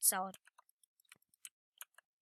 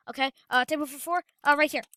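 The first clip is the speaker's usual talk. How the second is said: ordinary delivery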